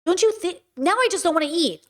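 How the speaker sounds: ordinary talk, nothing marked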